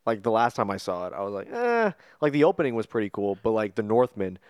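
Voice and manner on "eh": high dismissive eh; unimpressed. Retold or imitated. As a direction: shrug it off, unimpressed. Unimpressed reaction to remake.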